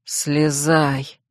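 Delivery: low, resigned order; weary sigh. A narrator's reading. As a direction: sigh the word out tiredly. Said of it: "вздохнул Ас".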